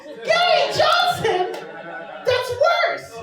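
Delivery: high, loud, slowed outrage at the name; appalled disbelief. Real, not acted. Hearing her date voted Gary Johnson, appalled high disbelief. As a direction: recoil in laughing horror.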